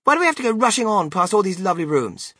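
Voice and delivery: high, loud, rapid protest; complaining girl. Acted. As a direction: protest fast like a thwarted child. Spoilt girl complains about rushing.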